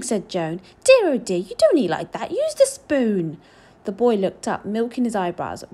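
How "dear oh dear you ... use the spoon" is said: put-on loud, exasperated old woman; loud tutting reprimand, slowing at the end; scolding. A narrator's reading. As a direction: scold the child with raised voice. Joan scolds boy's table manners.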